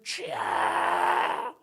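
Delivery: long strained cry, drawn out. Acted. Believer longing to cry out to the Lord, strained cry. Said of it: "부르짖고 싶은데".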